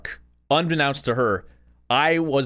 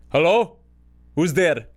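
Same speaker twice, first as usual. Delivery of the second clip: suspicious dad; 4 semitones higher, 4 dB louder, slower than usual. Retold or imitated. Dad answering the phone again.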